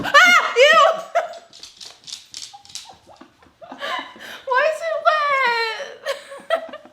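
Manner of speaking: high, loud squealing disgust; disgusted shriek. Real, not acted. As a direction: shriek at something slimy. Touching something wet in box.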